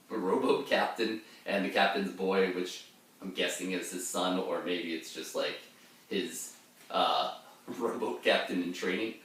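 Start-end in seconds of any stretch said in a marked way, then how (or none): none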